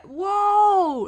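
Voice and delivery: high, loud excited whoa; surprised whoa. Real, not acted. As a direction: burst out in surprise. Game finally loads.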